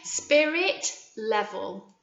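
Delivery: said slowly for copying; teacher modelling a word slowly; slow model word. Shown as a demonstration. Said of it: "My turn".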